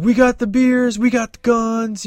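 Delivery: high-pitched mock crowd-rouser; rallying the crowd. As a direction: call it out high, as if working up a crowd. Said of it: "like, talking to the crowd".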